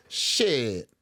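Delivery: normal talking voice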